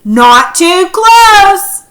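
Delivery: high, loud and very slow warning; drawn-out shouted warning; yelled warning. Shown as a demonstration. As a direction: yell it out as a stern warning. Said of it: "He could have yelled it".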